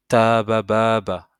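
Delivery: "tabababa" loud and slow, run together without a stop; fluid reading. Shown as a demonstration. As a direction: link every syllable of "tabababa", drawing out long vowels. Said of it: "de manière fluide". (« tabababa » lu de manière fluide, sans temps d'arrêt)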